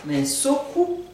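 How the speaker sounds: teacher models "Mais soku", rising on "soku". Shown as a demonstration. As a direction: rise on "soku" like a teacher demo. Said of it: "J'ai peur". («soku» avec une montée)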